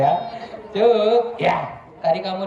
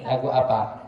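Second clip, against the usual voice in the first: usual speaking voice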